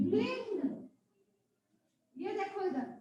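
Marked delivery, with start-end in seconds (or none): 0.1-0.6 भृंग as "bhring", with "ri"; correct pronunciation. Shown as a demonstration. Teacher giving the class the right form, भृंग said "bhring". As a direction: softly model भृंग with an i sound. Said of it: "इसे हम कहेंगे".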